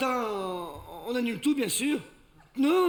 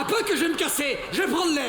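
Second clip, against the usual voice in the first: angry shouting; 6 semitones higher, 4 dB louder, faster than usual. Acted. Couple quarrel, he storms out.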